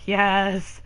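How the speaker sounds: loud happy yes; excited. Real, not acted. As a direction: cheer it with delight. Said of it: "I'm so excited".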